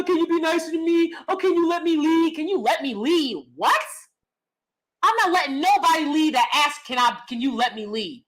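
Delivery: ordinary delivery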